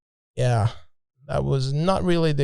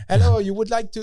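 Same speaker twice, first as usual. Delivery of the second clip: imitating a Frenchman's English, pitched up; French accent; 8 semitones higher than usual. Island guy offering a trip to the travellers, French accent. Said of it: "it was a French accent".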